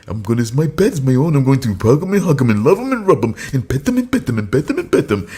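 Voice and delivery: fast, eager dim giant gushing; Mongo voice. Retold or imitated. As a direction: babble it out like a doting brute. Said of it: "the guy".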